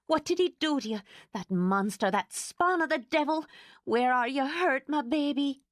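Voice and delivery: high, frantic fussing. Acted. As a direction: fuss over her in a panic. Frantic housekeeper fussing over Laura.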